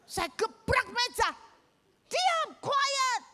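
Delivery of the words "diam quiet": high, strained teacher's yell; angry yell. Retold or imitated. Teacher silences a rowdy class, angry yell. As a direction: re-enact an exasperated order for silence. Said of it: "Saya marah, saya emosi".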